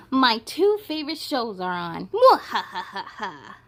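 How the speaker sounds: hammed-up monster laugh; gloating fear monster. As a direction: cackle like a cartoon monster.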